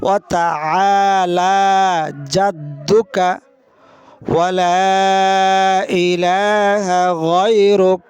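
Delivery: গায়রুক held long with a throaty গ; slow recitation demo. Shown as a demonstration. Teacher leading listeners through the Sana, slow recitation. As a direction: chant ওয়া লা ইলাহা গায়রুক very slowly. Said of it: "আবার আমার সাথে".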